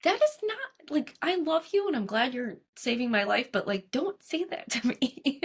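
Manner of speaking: high-pitched, lightly acted objection; mock protest. Patient answering her oncologist's remark, mock protest.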